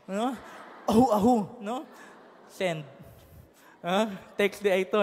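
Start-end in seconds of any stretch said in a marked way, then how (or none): 0.8-1.3 mock coughing to fake being sick; a couple of hammed-up coughs. Member fakes a cough to dodge cell group: mock cough.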